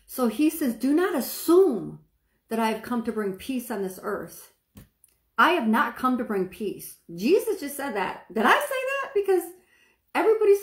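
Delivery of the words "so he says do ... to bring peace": normal talking voice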